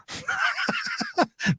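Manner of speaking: high-pitched laugh; real laughter. Real, not acted. Host tickled by a viewer's comment, laughing.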